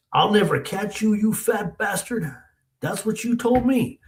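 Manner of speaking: high, heated retold threat; angry confrontation. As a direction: throw the insult back in his face. Angry man throws taunt back.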